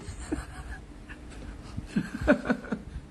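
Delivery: laughing. Real, not acted. Laughing at own birthing example.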